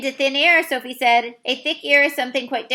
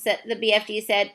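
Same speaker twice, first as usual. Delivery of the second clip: plain reading voice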